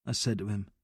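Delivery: ordinary delivery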